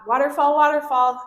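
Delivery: high, loud classroom call; attention grabber. Shown as a demonstration. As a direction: call out to refocus kids. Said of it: "Attention grabbers are a really fun way".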